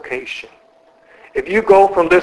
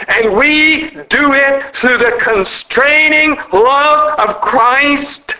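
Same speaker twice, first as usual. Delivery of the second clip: high-pitched, slow and stretched-out delivery; impassioned reading; 6 semitones higher, slower than usual. Real, not acted. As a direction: draw out each phrase at a raised pitch. Preacher reads climax of quotation.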